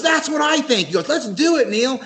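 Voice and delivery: high, eager imitation voice; Ace.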